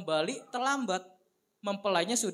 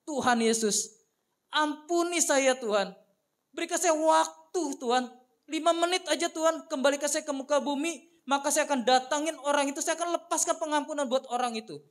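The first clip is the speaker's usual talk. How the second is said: loud, high begging prayer; desperate plea; 7 semitones higher, 4 dB louder than usual. Pretend. A soul in hell begging God, loud and high. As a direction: beg God for one more chance.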